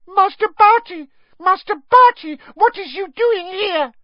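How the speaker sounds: very high, loud, squeaky house-elf wail; piercing elf shriek. A narrator's reading. Winky finding her young master on the floor, piercing squeaky shriek. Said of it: "she let out a piercing shriek".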